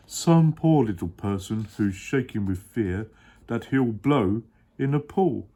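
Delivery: a big kind elephant voice, sure of himself; deep Horton voice, earnest. Acted. Dad as Horton explaining to his child.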